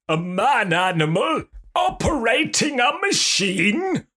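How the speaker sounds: high, loud mocking villain; hammed-up incredulous alien overlord; evil alien villain. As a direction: crow it like a pantomime baddie. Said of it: "that kinda evil laugh where they're talking".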